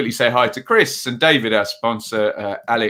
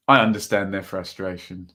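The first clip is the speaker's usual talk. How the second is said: ordinary talk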